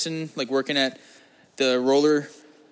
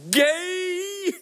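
way higher, bratty call-out; mock jeer. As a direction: imitate someone blurting the taunt. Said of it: "when someone just goes".

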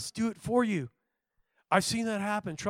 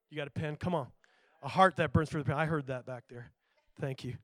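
normal talking voice

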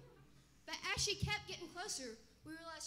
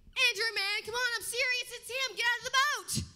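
loud, high call across the water; 6 semitones higher, 11 dB louder than usual. Acted. Peter calls Andrew out of the boat, loud and high. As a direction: yell to your friend to jump out.

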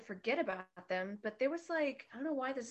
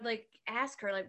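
ordinary talk, nothing marked

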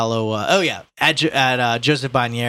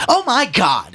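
high, loud theatrical dismay; 11 semitones higher, 6 dB louder, slower than usual. A narrator's reading. Son watching the policeman struggle, dismayed. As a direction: exclaim it loudly, pitched high.

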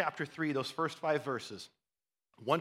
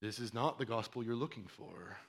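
1 Obi-Wan mind trick; 7 semitones lower, 11 dB quieter than usual. Retold or imitated. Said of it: "Jedi mind trick from Obi-Wan Kenobi".

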